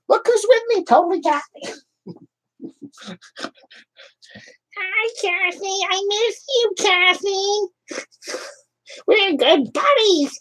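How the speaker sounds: a tiny puppet greeting its fans in a high squeak; Crotchy puppet voice. Acted. Puppet Crotchy greets the live chat viewers in a very high squeaky voice.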